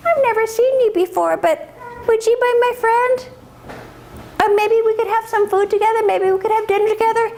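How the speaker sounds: very high, innocent calf voice; little calf. Acted. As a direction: naive young calf making friends. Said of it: "And the calf says".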